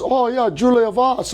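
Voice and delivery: high, bright-pitched Jamaican "Oh, yeah, man"; imitating Jamaican accent. Retelling the FaceTime guy's greeting, in a light Jamaican accent. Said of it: "a Jamaican accent".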